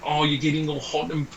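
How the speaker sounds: hammed-up New Zealand fan complaining in a New Zealand accent; a jokey New Zealand accent aimed at a Kiwi cricket fan. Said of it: "I'm gonna do your accent here".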